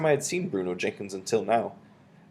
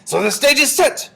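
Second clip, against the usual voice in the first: loud, high shouted announcement; witch's shout; 9 dB louder than usual. A narrator's reading. Grand High Witch opens the proceedings, shouting. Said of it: "shouted the Grand High Witch".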